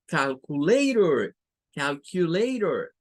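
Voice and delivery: calculator, ending softened to -rer; English calculator. Shown as a demonstration. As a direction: calculator demonstrated slowly. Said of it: "la pronunciación para esa".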